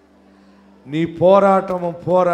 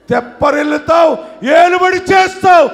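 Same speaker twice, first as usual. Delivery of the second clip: high, loud declarations with pauses between; full-force preaching; 8 semitones higher, 5 dB louder than usual. Real, not acted. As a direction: proclaim each promise to the crowd. (ఆవేశంగా, గట్టిగా ప్రవచనం)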